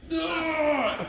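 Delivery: high mock groan of frustration; frustrated groan. Actor retelling forgetting his line, mock frustrated groan. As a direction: groan at yourself for a lost line. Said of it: "and I was like".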